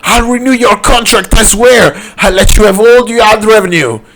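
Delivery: hammed-up panicked plea; desperate pleading. A narrator's reading. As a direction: bargain frantically for your life. Boss begs for his life.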